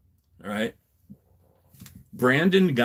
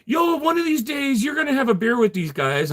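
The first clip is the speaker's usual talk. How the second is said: high-pitched mocking quote; mock what people say, pitched way up; imitating others; 12 semitones higher than usual. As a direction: put on a high voice mimicking naive advice. Voicing what some people say.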